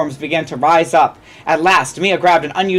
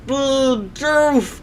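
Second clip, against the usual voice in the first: higher, eerie creature voice; hungry monster; 6 semitones higher than usual. Acted. Undead Bidoof closing in on Mia, hungry. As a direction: croon its name with hunger. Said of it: "eyes looking hungrier still".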